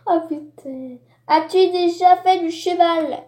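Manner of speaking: ordinary talk, nothing marked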